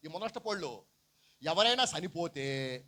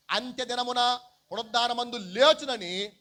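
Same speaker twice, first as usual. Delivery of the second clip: loud, high, slow declamation; scripture reading; 6 semitones higher, 9 dB louder, slower than usual. Acted. Preacher quoting Martha's verse to the congregation, loud scripture reading. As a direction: proclaim the verse slowly and loudly.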